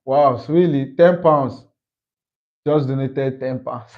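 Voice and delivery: usual speaking voice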